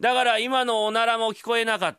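speaking up. Acted. Loud talk with deaf lady.

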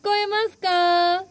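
calling out. Real, not acted. Calling into the talking pipe.

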